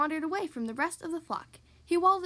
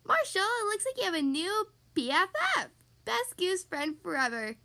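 chuckling; 5 semitones higher than usual. A narrator's reading. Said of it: "Sky chuckled".

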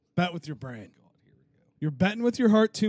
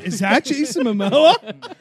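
amazed; 7 semitones higher, 10 dB louder than usual. Retold or imitated. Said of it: "I'm like".

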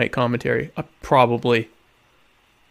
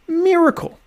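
dramatic exclamation. Acted. Proclaiming his survival.

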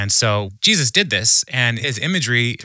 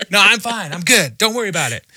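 higher-pitched mock reassurance that all is fine; acted cheery denial; insistent denial; 8 semitones higher than usual. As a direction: brush off concern too brightly, voice lifted. Voicing someone pretending all is fine.

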